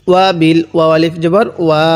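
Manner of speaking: ordinary talk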